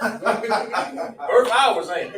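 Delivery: high, loud street talk with laughter; amused, punchy everyday phrasing; casual vernacular. Shown as a demonstration. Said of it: "that's how they talk".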